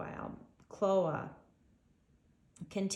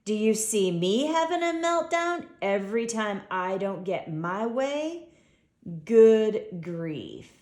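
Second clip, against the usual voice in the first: a louder, mocking giraffe sneer; jeering; 7 dB louder than usual. A narrator's reading. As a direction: talk down to him with a sneer. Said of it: "briefly lowers his gaze and jeers".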